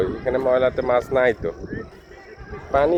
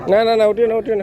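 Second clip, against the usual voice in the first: alarmed calling out; 9 semitones higher, faster than usual. Real, not acted. Father urgently stopping his child from climbing up.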